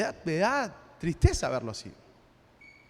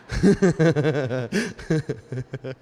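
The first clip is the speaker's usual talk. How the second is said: real laugh; 6 semitones lower than usual. Real, not acted. Joke about visiting churches.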